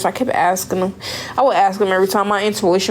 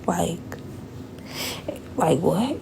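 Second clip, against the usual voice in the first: stunned whisper; 3 semitones lower, 10 dB quieter, slower than usual. Retold or imitated. Stunned by herpes diagnosis.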